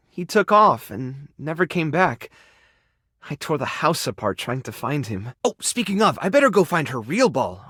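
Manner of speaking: ordinary delivery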